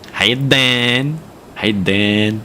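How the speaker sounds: stretched New York greeting; Tony Cash. Retold or imitated. Streamer imitating TikTok street comic, New York accent. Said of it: "Tony Cash".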